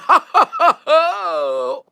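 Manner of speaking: high "ha ha ha" trailing into a long oh; high, wailing laugh; high laughter. Real, not acted. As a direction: laugh high and let it slide out. Reacts to Laughing Man.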